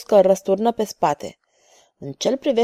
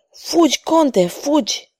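slow, higher rasped warning; rasping warning; 6 semitones higher, slower than usual. A narrator's reading. Wounded monk rasps to the count to flee, slow and high. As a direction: croak it out from the floor. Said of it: "horcăi".

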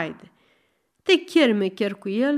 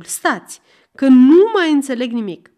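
exasperated shout; 3 semitones higher, 8 dB louder than usual. Acted. Cadi shouts for quiet.

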